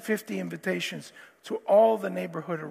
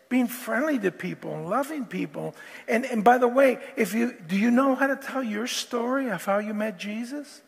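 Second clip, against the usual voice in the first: ordinary delivery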